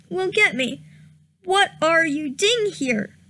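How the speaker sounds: ordinary talk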